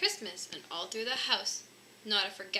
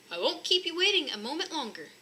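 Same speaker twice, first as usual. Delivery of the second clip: cried; 7 semitones higher, 3 dB louder than usual. Acted. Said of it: "he cried".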